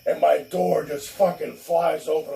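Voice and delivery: normal talking voice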